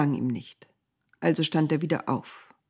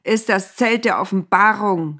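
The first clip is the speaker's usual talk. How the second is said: louder call to the crowd; shouting; 3 semitones higher, 6 dB louder than usual. A narrator's reading. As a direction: call it out to keep them away. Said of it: "schrie er den Leuten zu".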